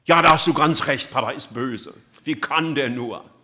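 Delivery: hammed-up mum siding with her child; indignant mother. Mother agreeing loudly with her upset child. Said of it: "Was sagt die Mama?".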